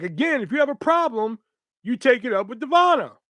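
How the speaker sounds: high, insistent retort, short of a scream; retold screaming. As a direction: re-enact the angry yell, half-strength. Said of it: "I start screaming at him".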